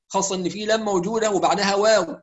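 ordinary talk

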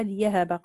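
ليهب as "li-yahaba"; hamza replaced by ya. Shown as a demonstration. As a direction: say ليهب with a y where the hamza was. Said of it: "الإبدال فيها".

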